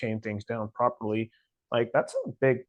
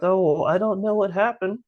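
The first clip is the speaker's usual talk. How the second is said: high, loud feigned innocence; mock cluelessness; 10 semitones higher, 6 dB louder than usual. Mimicking people who ignore history, mock innocence. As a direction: play dumb in a mocking high voice.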